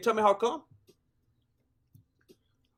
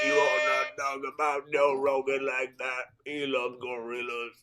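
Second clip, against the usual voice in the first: deep mock impression; 7 semitones lower, 6 dB quieter than usual. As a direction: lower and softer put-on voice. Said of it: "That's the best I can do right now".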